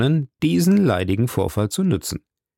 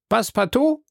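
much higher, questioning call; clerk calling out; 12 semitones higher than usual. A narrator's reading. Clerk calling Passepartout's name, high questioning call.

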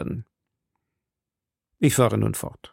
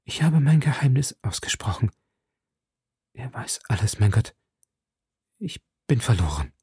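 unvoiced whisper sinking to despair; lowered voice; 3 dB quieter than usual. A narrator's reading. Milady whispers her despair after confessing her secret. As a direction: whisper as if all is lost. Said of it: "senkte ihre Stimme".

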